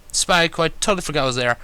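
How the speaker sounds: usual speaking voice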